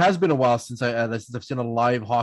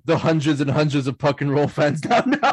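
normal talking voice

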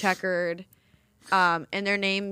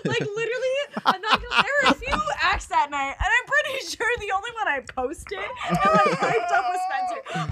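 laughing while talking higher; 9 semitones higher than usual. Real, not acted. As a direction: laugh through the words. Laughing, flustered denial.

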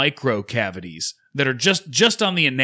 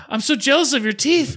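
much higher, louder, gushing dentist impression; mock gushing dentist; 13 semitones higher, 4 dB louder than usual. Dentist gushing over the patient's teeth, high mock voice. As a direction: coo it like an envious dentist.

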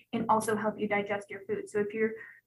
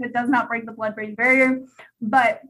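usual speaking voice

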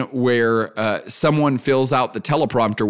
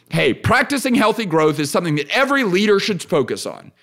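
firm, closed declaration; assertive statement; 6 semitones higher than usual. Shown as a demonstration. Podcast host demos talking at people, assertive. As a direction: state it as absolute fact, no opening. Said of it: "if I say it like that".

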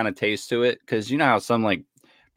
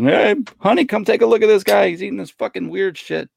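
high, loud gawking bystander; amused onlooker voice; 11 semitones higher, 5 dB louder than usual. Retold or imitated. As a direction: call your partner over to gawk. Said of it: "Steve's just having a fucking time of his life".

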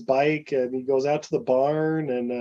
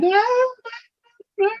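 retold screaming; much faster than usual. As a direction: imitate her screaming at half volume.

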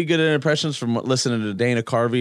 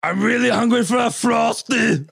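much higher, loud and slow Arnold voice; Arnold impression; 11 semitones higher, 3 dB louder, slower than usual. Shown as a demonstration. Cohost keeping his Arnold going, slow and shouted. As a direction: shout it like a hungry action hero. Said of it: "Arnold impression".

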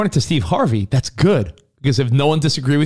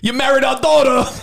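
high-pitched mock Brooklyn in-law; Brooklyn accent; 11 semitones higher than usual. Playing Brooklyn in-laws to a son-in-law, high Brooklyn accent. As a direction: exclaim it in a hammed-up Brooklyn accent. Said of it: "still have thick Brooklyn accents".

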